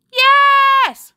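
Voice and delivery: high, loud shouted yes; crowd cheer. A narrator's reading. Pupils cheer back at their leader, shouting.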